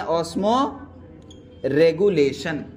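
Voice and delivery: Osmoregulation dragged out slowly, syllable by syllable; slow drill of osmoregulation for students to repeat; drawn out slowly. Shown as a demonstration. Teacher urging the class to repeat osmoregulation, said slowly and drawn out. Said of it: "बोलना, बोलना".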